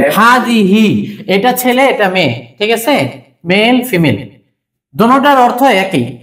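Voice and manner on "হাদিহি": slow drill word. Shown as a demonstration. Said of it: "drilling Arabic words aloud".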